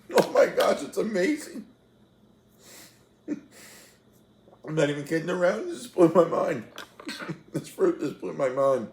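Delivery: high, loud, laughing, teary exclamations; laughing through happy tears; tearful joy. Real, not acted. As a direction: overcome by a pineapple, almost crying. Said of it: "I'm tearing up over a pineapple".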